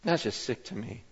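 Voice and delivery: fading to a low, soft, revolted hush; quiet disgust. Retold or imitated. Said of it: "He goes".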